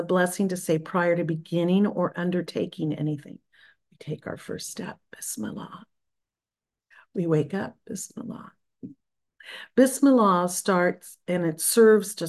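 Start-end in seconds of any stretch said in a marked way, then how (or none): 3.9-5.8 soft whispered blessing. Shown as a demonstration. Teacher whispering the blessing to the class. 7.9-8.5 soft whispered blessing. Shown as a demonstration. Teacher whispering the blessing to the class.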